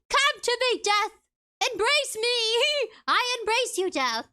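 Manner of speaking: high, loud melodramatic plea; dramatic plea. Retold or imitated. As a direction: call to death like a tragic heroine. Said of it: "It's like".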